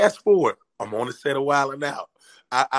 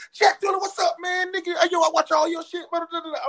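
much higher, thrilled recognition; excited greeting; 13 semitones higher than usual. Retold or imitated. Nick bursting out the door, much higher and thrilled. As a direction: greet him like a long-lost star.

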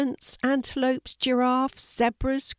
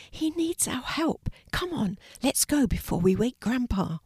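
hushed urging; 6 semitones higher than usual. A narrator's reading. Secret urging near sleeping Grandpa.

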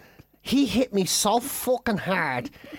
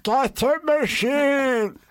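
slow, drawn-out strained plea; winded pleading; 3 semitones higher, much slower than usual. Retold or imitated. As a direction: keep moaning for help as if still floored. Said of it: "I was just still lying there going".

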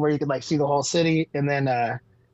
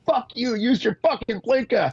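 imitated road rage; 8 semitones higher than usual. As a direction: imitate an outraged driver cursing out the window. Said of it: "roll the window down and be like".

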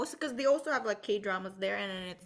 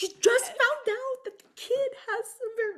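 mock translating; 11 semitones higher, 3 dB louder than usual. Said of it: "And it's like".

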